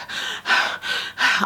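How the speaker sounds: a long heavy breath out, like after a run; breathless sigh. Shown as a demonstration. Pregnant mum showing how winded she feels, heavy sigh. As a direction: puff out the air as if winded. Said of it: "you're just out of breath".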